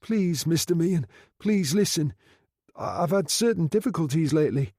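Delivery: pleading in fear. A narrator's reading. Frightened man begging his boss to listen. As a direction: beg him, scared. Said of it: "shaking like a leaf".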